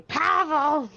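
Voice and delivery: high, obnoxious call-out; hollering for someone, high-pitched and obnoxious; obnoxious yell. Acted. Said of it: "yell really obnoxiously".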